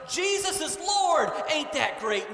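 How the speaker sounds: high, loud, glad proclamation; joyful shout. A narrator's reading. Church member shouting joyful news to outsiders, loud. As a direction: shout the good news for all to hear. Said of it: "and shouted".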